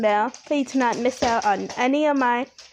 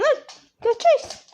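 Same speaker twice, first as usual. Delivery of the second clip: talking to a dog; 12 semitones higher, 3 dB louder than usual. Real, not acted. Urging dog to chase.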